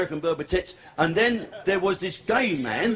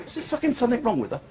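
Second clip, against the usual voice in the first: normal talking voice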